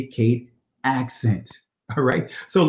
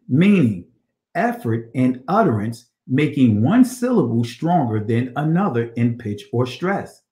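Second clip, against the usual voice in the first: ordinary talk, nothing marked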